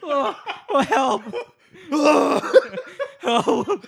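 high mock wail breaking into laughter; playful high-pitched distress; mock plea for help. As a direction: cry for help through giggles.

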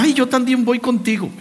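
a hint of a chatty friend; imitating women heading to the restroom; mock woman. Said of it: "Y se levanta la otra".

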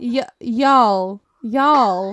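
loud British attempt at Y'ALL; British-accented y'all. Shown as a demonstration. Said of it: "It sounds so bad, like, in a British accent".

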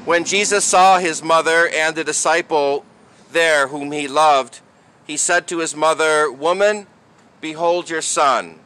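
solemn proclamation read aloud; gospel reading. Real, not acted.

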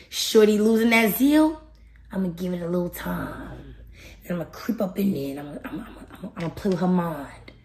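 low, sly tempter with a chuckle; the devil. Acted. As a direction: scheme softly like a creeping tempter. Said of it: "the devil wants you because he's like".

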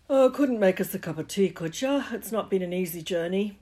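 lightly voiced big friendly giant; a hint of a gruff giant; giant's voice. Giant arrives, asks for tea.

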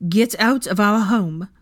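slow, loud, angry order; forceful, drawn-out command; ground out. A narrator's reading. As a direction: order them out, leaning on each word. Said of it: "Hermione ground out".

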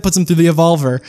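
ordinary talk

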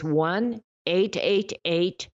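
slow, spaced-out digits to write down; slow dictation. Shown as a demonstration. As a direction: read the number slowly, digit by digit. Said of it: "I'll say it slow".

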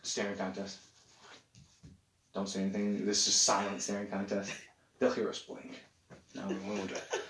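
playful whisper during a silent staring contest; hushed. Acted. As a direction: hush so they can't hear us blink.

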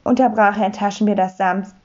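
ordinary delivery, nothing marked

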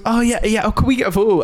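lightly voiced eager fan, pitched high; eager girl. Girl asking podcaster for a photo, high and eager. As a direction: imitate a keen girl in a high voice.

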